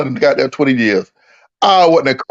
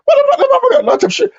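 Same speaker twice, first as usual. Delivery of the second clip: very high, hurried tearful wail; mock-hysterical crying; retold crying; faster than usual. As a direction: imitate a blubbering squeal, words tumbling out. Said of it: "I was crying like a bitch".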